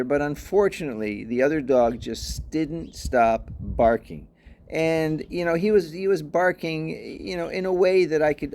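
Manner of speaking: usual speaking voice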